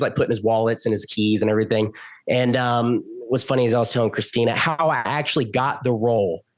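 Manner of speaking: ordinary delivery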